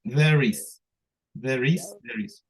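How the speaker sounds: There is blended into one; teacher demo of linking; linked there is. Shown as a demonstration. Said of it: "las pueden unir".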